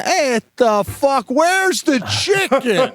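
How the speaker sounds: high, slow, incredulous mock outrage; drawn-out high complaint about the missing chicken; exasperated outburst. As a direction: play the starving guest losing patience. Hungry guest fed up at party.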